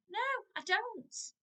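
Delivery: put-on high, slow stubborn child's refusal; defiant child. Shown as a demonstration. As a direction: drag it out like a sulky toddler. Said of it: "expect a no".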